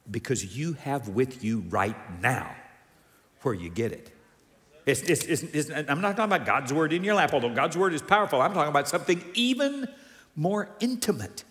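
ordinary delivery

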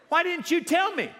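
high, loud mock outrage; indignant husband. Husband's retort in acted exchange.

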